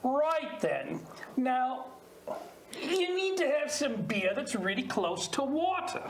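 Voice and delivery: put-on Australian accent for improv; Aussie accent. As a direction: talk like an Aussie bloke. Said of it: "Give us an Aussie accent".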